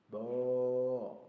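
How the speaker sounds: loud held moo; cow moo. Shown as a demonstration. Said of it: "that's a cow".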